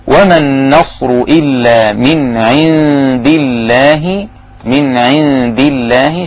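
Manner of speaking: slow, drawn-out Quranic chant; Quran recitation. Acted. Teacher chants the verse slowly for students.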